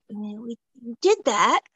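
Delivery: baffled, how big is this cast; retelling getting the callback, puzzled and high; incredulous. Retold or imitated. Retold confused reaction.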